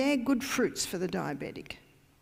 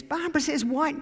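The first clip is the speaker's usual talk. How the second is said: higher, louder mock outrage at the claim; a caller phoning the husband, higher and louder in disbelief; incredulous caller; 7 semitones higher, 4 dB louder than usual. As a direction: voice the baffled caller, pitch climbing up. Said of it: "and she says".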